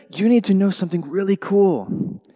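usual speaking voice

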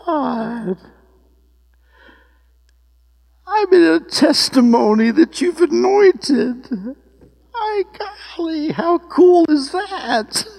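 very high, slow, crying voice; high drawn-out tearful wonder; tearful awe. Retold or imitated. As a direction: cry out to God, overcome, stretching the words. Overwhelmed retelling, everybody cries.

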